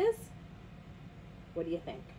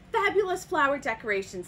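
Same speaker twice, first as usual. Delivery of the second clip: excited discovery; 5 semitones higher, 6 dB louder than usual. A narrator's reading. Toucan finds what he needs.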